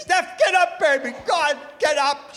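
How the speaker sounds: loud urgent wake-up call; calling loudly to wake sleeping wife. Retold or imitated. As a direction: shout her awake.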